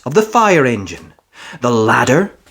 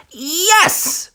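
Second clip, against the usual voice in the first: high, excited yes; eager cry. A narrator's reading. Peppa answering Miss Rabbit, eager cry. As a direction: burst out keen. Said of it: "cried Peppa".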